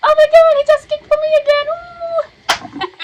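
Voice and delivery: very high, loud, fast excited outburst; excited squeal. Retold or imitated. Mother reacting to baby's kick, excited squeal. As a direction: squeal it with delight, then whoop.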